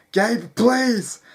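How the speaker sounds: loud, high cry for his brother; desperate plea. Acted. Boy calling to brother as the horse gallops off, desperate plea. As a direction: cry out pleadingly. Sam pleads as horse carries him off.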